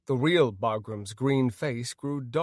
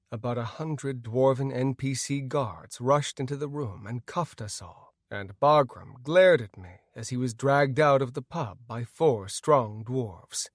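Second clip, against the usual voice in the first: normal talking voice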